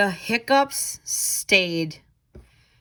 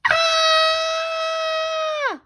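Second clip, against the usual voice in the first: high, held storybook scream; skeleton scream. Acted. Skeleton sees himself in the mirror and screams. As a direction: let out a long high scared shriek. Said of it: "Skeleton screamed".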